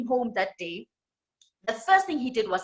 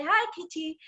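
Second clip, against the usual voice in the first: timid puppy; 7 semitones higher than usual. Acted. Timid puppy greets cat.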